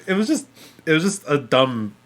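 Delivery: usual speaking voice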